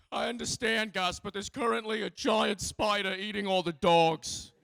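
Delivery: a breezy, unbothered Australian politician; conservative politician. Retold or imitated. Comic telling the crowd about an Australian politician, high and breezy. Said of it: "and he went".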